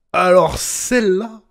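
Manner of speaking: high, loud, drawn-out incredulity; loud disbelief. Real, not acted. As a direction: stretch it out, loud and stunned. Escalating incredulous reaction, peak. (incrédulité forte et étirée)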